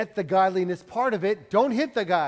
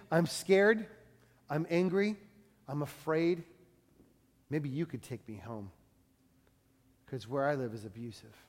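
hidden plea; 4 semitones lower, 7 dB quieter than usual. Acted. Mall people's hidden cry.

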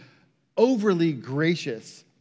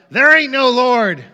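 loud, raised retort; angry shout; 5 semitones higher, 9 dB louder than usual. Retold or imitated. Atheist neighbor answering the lady's proclamations, angry shout. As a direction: shout it back as a hot-headed denial. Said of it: "he would shout".

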